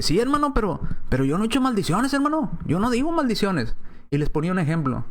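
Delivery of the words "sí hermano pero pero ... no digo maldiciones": high, whiny imitation of a believer protesting; imitating a member who insists he doesn't curse; mock pious objector. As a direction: play the defensive churchgoer, pitched up.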